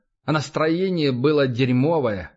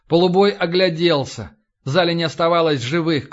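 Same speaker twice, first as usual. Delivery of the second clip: ordinary delivery, nothing marked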